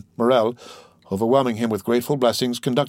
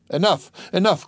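higher-pitched overwhelmed plea; almost overpowered; 8 semitones higher than usual. A narrator's reading. Owner overcome by sailors' loyalty, almost overpowered. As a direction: beg them to stop, moved. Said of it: "cried Morel, almost overpowered".

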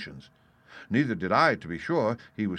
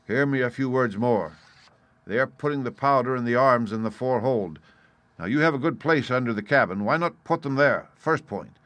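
louder, emphatic sea captain; lightly voiced captain laying down his objections; blunt captain, airing grievances; 4 semitones higher, 6 dB louder than usual. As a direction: state it firmly, punching key words. Voices captain listing his demands.

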